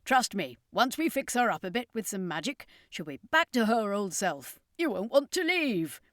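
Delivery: put-on confident old showman; circus master, proud. A narrator's reading. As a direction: reassure them with pride.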